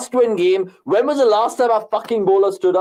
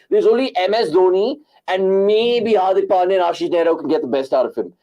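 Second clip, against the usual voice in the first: ordinary delivery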